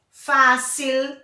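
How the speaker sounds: fácil with a strong first syllable; stress on FÁ. Shown as a demonstration. Teacher gives an example of graves, stressing the first syllable of fácil. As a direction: hit the fá of fácil. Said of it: "tem acento no fá".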